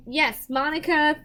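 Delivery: ordinary talk, nothing marked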